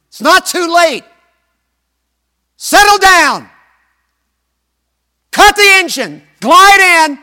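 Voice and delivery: high, loud shouting voice of doubt. Retold or imitated. Preacher voicing doubt yelling at Lindbergh to turn back, loud and high. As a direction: yell it down at the pilot, stop. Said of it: "I heard that voice said".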